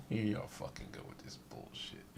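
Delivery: whispered through a laugh; exasperated giggling aside; laughing, unvoiced disbelief. Real, not acted.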